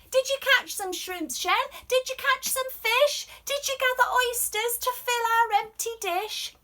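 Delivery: high, loud questioning voice; a family member loudly quizzing Shen; demanding family. Acted.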